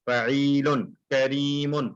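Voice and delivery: फ़ईलुन then करीमुन, the second slow and drawn out; match करीमुन to the फ़ईलुन beat, slowly; teacher shows that करीमुन follows the फ़ईलुन pattern; pattern sound demo. Shown as a demonstration. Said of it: "इसमें फ़ईलुन का sound आएगा".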